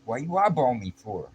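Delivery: loud, high street confrontation; controlling drunk man starting trouble, confrontational; sudden challenge. Retold or imitated. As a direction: pick a fight with one line. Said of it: "looks at me out of nowhere and goes".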